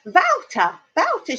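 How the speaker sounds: high-pitched "Wouter" said as vow-ter; insistent mispronunciation. Retold or imitated. Pupil insisting on what her teacher taught, imitated mispronunciation. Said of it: "My English teacher taught me".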